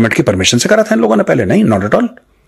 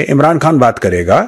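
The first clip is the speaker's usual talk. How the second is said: ordinary delivery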